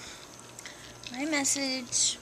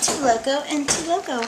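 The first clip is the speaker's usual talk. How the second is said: loud; 3 semitones higher, 11 dB louder than usual. Pretend. Loud bar order in character.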